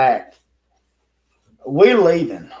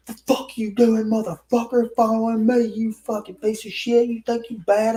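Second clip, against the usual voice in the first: higher-pitched mock rage at a stranger; imitating angry man; 8 semitones higher than usual. An angry stranger cursing at the narrator for following him. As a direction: spit it out like a furious stranger.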